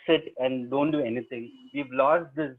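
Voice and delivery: ordinary delivery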